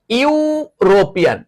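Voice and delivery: slow, high "ইউরোপিয়ান" with a drawn-out "ইউ" start; pronunciation demo. Shown as a demonstration. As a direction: stretch the "ইউ" that opens "ইউরোপিয়ান". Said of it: "ই এর উচ্চারণ কার মতো হয়েছে?".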